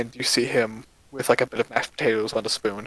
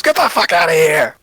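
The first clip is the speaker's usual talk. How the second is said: loud roaring threat, cleaver raised; furious shout; 4 semitones higher, 10 dB louder than usual. Acted. Dwarf screaming at the cat druid to leave. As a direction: bellow him off your property. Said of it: "And just goes".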